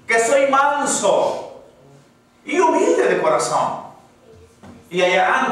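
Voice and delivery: high, loud preacher's cry to the congregation; fiery preaching. Acted. Pastor exhorting the church in a loud, soaring preaching voice. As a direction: preach it full force, lifting the crowd.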